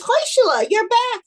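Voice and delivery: high, loud cry of welcome; excited greeting. Acted. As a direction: greet a returning visitor with a burst of energy. Rabbi greets returning visitor at door.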